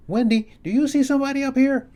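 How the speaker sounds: high, loud call down the stairs; yelling downstairs. Retold or imitated. He yells down the stairs to his wife, loud and high. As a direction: call out to her from the landing. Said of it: "So I yell downstairs".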